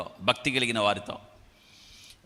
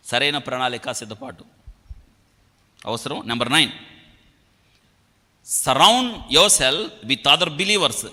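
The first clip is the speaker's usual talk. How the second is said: ordinary delivery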